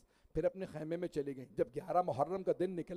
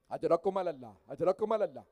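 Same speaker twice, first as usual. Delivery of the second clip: chanted mourning recital, full voice; majlis recitation; 14 dB louder than usual. Acted. Reciter mourning the martyrs before the congregation, chanted lament.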